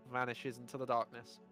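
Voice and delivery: ordinary talk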